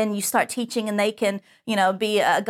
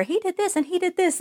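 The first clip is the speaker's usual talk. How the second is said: higher-pitched kid telling on someone, lightly voiced; tattling child; 6 semitones higher than usual. Kids telling on him to teacher.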